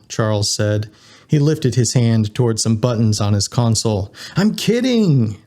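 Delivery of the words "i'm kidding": high, drawn-out teasing exclamation; joking reveal. A narrator's reading. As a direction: stretch it out, pitched way up. Charles drops his threat of arrest.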